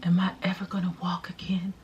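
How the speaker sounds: hammed-up frail whisper; dramatic whisper. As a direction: whisper like a dying soap heroine. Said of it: "drama has to be on ten".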